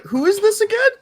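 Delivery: confused. Retold or imitated. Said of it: "you're probably gonna be like".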